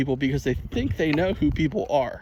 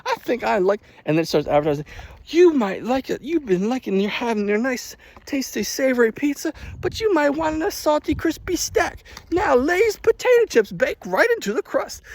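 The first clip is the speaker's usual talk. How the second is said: oversell it like a TV ad; mock ad pitch; 10 semitones higher than usual. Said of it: "she's like".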